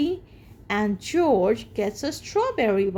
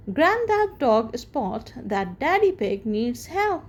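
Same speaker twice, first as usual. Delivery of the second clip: plain reading voice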